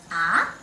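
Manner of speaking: ah lifting from bottom to top; rising second tone. Shown as a demonstration. Teacher confirming second tone with student, rising ah. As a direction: curl ah upward like asking. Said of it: "it's up tone".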